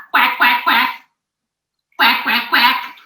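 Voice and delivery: short repeated quacks in threes; playful duck quacks. Pretend. Mother being the yellow duck for her son, playful quacking. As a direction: quack like a picture-book duck.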